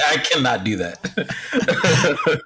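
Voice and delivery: slow words through laughter; laughing. Real, not acted. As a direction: say it while cracking up. Laughs after failed yell.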